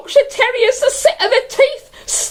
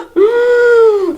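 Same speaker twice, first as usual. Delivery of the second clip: swooning gasp; 3 dB louder than usual. Acted. Swooning over Roger's smile.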